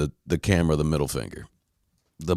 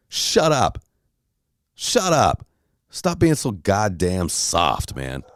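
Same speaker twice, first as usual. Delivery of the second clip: mock yell; 2 semitones higher, much slower than usual. Yelling at imaginary Chad.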